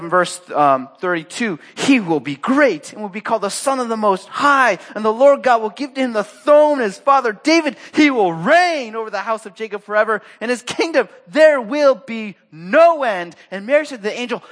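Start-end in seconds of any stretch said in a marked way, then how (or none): 1.8-13.4 high, loud, ringing proclamation; preacher declaiming a grand prophecy; impassioned scripture reading. Acted. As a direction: proclaim it with building force. Reads scripture at full force.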